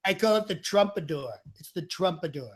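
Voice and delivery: Trump with an announcement; Trump impression. Trump tells interviewer about his hairstyle, Trump impression. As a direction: introduce it proudly as Trump.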